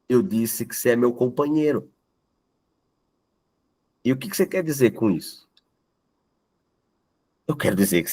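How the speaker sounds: lightly voiced dialogue between A and B; story reading. Teacher reading a short story dialogue to the class, lightly voiced. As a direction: read the dialogue aloud with small shifts between the two speakers.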